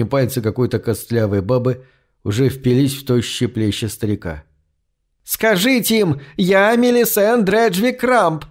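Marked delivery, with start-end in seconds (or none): 5.2-8.5 high, slow shrill screech of a bony crone; shrieking old woman. A narrator's reading. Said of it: "верещала она". (визгливо верещит, высоко и протяжно)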